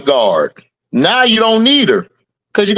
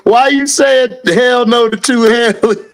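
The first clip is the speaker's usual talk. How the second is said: laughing; 4 semitones higher than usual. Real, not acted. Laughing at a chat comment.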